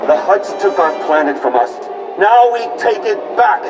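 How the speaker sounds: high, rousing call to arms, building; rally the fighters, rising to a shout; impassioned rebel speech, climbing in pitch; rallying battle cry. Acted. Rebel leader rallies fighters for battle.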